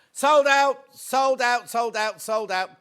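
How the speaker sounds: loud triumphant chant that fades a little each time; excited announcement. Real, not acted. Presenter announcing sellout to viewers, loud triumphant chant. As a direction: celebrate it selling out.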